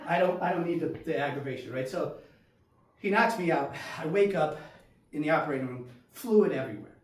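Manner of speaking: ordinary talk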